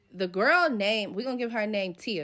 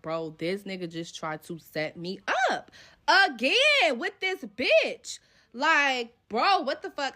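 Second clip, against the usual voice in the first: exasperated; 2 semitones higher, 3 dB louder, slower than usual. Retold or imitated. Telling homegirl on phone.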